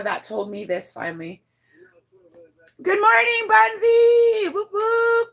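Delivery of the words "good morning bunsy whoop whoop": high, loud sing-out hello; excited greeting. Real, not acted. Streamer welcomes a viewer mid-story, excited high greeting. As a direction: whoop out a big drawn-out welcome.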